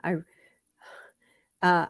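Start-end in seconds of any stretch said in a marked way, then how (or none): none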